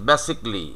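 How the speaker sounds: "basically" in three syllables, BASIC-lee; "basically" as basik-li, falling at the end; correct "basically". Shown as a demonstration. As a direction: say "basically" with the middle "ca" dropped. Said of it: "আমাদের উচ্চারণ দাঁড়াচ্ছে এরকম".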